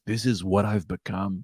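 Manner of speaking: soft, slow, quiet brooding; mock lament. Johnny Cage mourning his lost fame, slow and soft. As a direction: sink into self-pity, slow and hushed.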